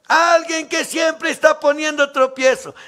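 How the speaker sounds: preaching shout. Acted. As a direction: denounce the stumbling block.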